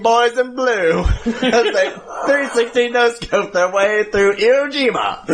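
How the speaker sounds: laughing newsreel announcer voice; cracking up through a newsreel narration; old newsreel. Pretend. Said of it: "in one of those old newsreels".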